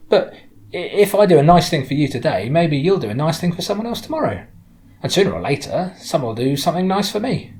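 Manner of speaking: friendly woman driver, upbeat. Acted. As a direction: make a lively case for kindness.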